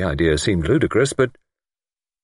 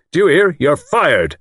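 louder, higher angry shout; 8 semitones higher, 6 dB louder than usual. A narrator's reading. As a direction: shout the dismissal in a rage. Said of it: "Lord Whitfield was shouting".

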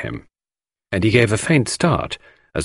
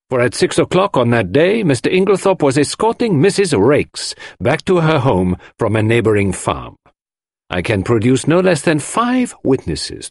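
higher-pitched, emphatic public declaration; Poirot, grand proclamation; 6 semitones higher than usual. Acted. Poirot faces everyone to clear the suspect, triumphantly. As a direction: declare the alibi with showman's flourish.

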